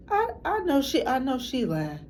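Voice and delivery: retold disbelief, pitched high; incredulous reaction. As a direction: start high in mock disbelief and ease down. Acted disbelief at a teacher.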